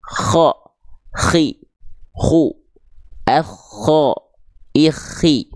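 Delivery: "Kho, khi" with a light rattling rasp on each kh; slight snore on kh. Shown as a demonstration. Teacher passing on their own teacher's way, lightly rattled kh. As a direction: add a little snore to the breath of every kh. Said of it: "dengkurannya sedikit".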